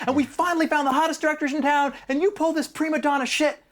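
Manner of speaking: high, mocking imitation of a raging producer; mock furious yell. Producer screaming at screenwriter over the phone, mock furious yell. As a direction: rant at the writer in a hammed-up high yell. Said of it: "screaming at me".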